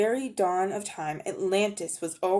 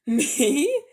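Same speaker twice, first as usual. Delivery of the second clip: shocked, offended outburst, higher and louder; exclaimed; 8 semitones higher, 7 dB louder than usual. Acted. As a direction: blurt it out as if insulted by the question. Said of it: "he exclaimed".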